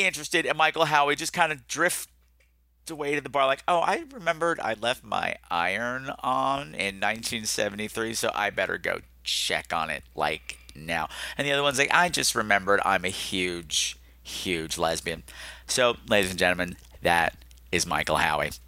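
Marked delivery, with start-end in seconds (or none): 3.7-11.1 soft, sinking fake excuse; mock lame excuse. Voices girl making excuse to escape. 11.9-15.2 slow, low-pitched deadpan; deadpan mock excuse. Voices second girl's escape excuse.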